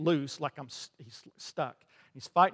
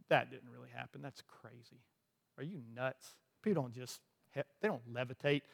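doubting inner voice; 4 semitones lower, 10 dB quieter than usual. Retold or imitated. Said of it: "I hear my own mind say".

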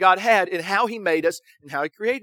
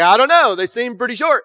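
louder, higher, unconvinced protective father; doubtful dad; 4 semitones higher, 7 dB louder than usual. Retold or imitated. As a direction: push back, louder, at the wife about the shorts. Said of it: "But he's like".

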